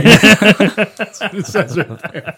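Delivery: words squeezed out through laughter; trying to read but cracking up; laughing. Real, not acted. Laughing while reading own text.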